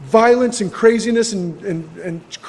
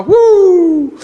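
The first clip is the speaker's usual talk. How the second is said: excited whoop; 9 semitones higher, 4 dB louder than usual. Retold or imitated. First hit of the joint.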